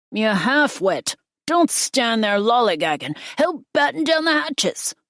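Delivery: loud, high-pitched scolding yell; angry shouted order. A narrator's reading. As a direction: yell it across a stormy deck. Leo barks orders in storm.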